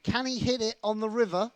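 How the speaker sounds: high, loud play-by-play climax; sports commentator at full lift; commentary voice. Real, not acted. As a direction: call out the suspense before the last card. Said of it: "That was my commentary voice".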